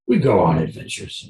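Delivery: the dog's catchphrase, lightly voiced and unhurried; Luigi impression. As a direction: give the dog a knowing, lightly voiced line. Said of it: "that's the best Luigi impression I can do".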